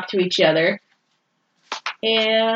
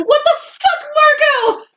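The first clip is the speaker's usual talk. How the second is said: mock outrage. Reader scolding Marco over the incident, high mock outrage. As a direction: scold in an incredulous, soaring pitch.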